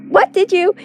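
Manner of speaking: high, loud furious scream; shrieking demand. A narrator's reading. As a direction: scream it at him. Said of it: "Sif is screaming now".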